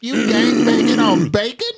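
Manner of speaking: high, slow, drawn-out question; comedian's mock outrage. Quoting an old joke about thugs angry at breakfast, mock outrage.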